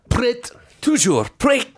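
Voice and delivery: "Prêt", "Toujours" pitched high, word by word; copying French pronunciation. Retold or imitated. Host parrots the computer's French, high isolated "Prêt" and "Toujours". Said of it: "That's how the computer voice says it".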